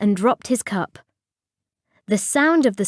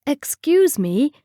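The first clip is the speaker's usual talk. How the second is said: higher, polite request; 6 semitones higher than usual. A narrator's reading. Timid customer addressing the tea lady, polite. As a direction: ask politely with pitch raised. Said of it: "he said politely".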